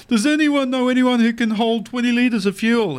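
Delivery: mock yell. Dad telling a joke, guy yells to the crowd, mild mock yell. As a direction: call it out to the whole crowd, only half shouting. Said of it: "he yells out".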